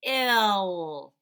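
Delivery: "Ill." said loud and drawn out; sounding out. Shown as a demonstration. Teacher modelling hill's sounds. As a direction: stretch "Ill." for the children. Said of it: "Three sounds".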